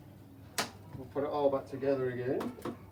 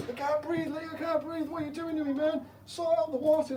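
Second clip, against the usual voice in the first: much higher, desperate little fish voice begging for air; a fish pleading for air, pitched way up; gasping fish; 12 semitones higher than usual. Acted. Suffocating fish pleads with Lee.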